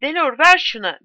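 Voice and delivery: anxious to grab the cup; flustered. A narrator's reading. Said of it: "Giuliano telaşla". (telaşlı, yüksek sesle)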